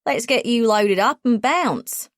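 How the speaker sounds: upbeat, breezy "bounce" said full voice; breezy Australian guide. A narrator's reading. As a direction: toss it off like a laid-back Aussie guide. Cooper's cheery call to leave.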